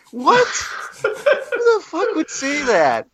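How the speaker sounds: high, laughing disbelief, slow; incredulous. Real, not acted. Reacting to clunky comic book dialogue, laughing disbelief.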